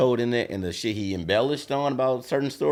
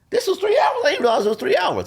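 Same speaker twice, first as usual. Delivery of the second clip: amazed civilian. Retold or imitated. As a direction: blurt it out in disbelief. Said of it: "the civilian in me is like".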